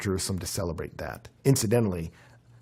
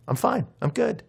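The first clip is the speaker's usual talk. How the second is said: mock breezy reassurance; 12 semitones higher than usual. Imitating a falsely cheerful reply.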